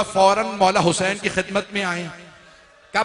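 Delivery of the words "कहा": usual speaking voice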